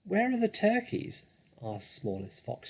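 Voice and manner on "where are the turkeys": put-on high, young cub voice; young fox. A narrator's reading. Smallest fox asks where the turkeys are in a high cub voice. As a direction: ask like an eager little cub.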